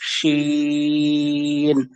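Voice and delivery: শিন held out long, chanted; long drawn shin. Shown as a demonstration. Teacher demonstrating a long held শিন. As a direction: stretch শিন for three counts. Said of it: "Bangla তালব্য শ এর মতো".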